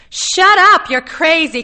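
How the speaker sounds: high, very loud outburst; panicked, high-pitched yell; horrified shout. A narrator's reading. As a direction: yell it to shut him up. Connie screams at Arnold to stop.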